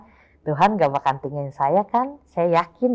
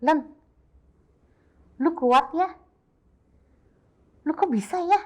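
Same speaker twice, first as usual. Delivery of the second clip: higher, marvelling voice; imitating amazed friends; 5 semitones higher than usual. Friends marvel at how she stays strong, higher voice. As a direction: mimic friends in awe of her strength. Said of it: "banyak orang bilang".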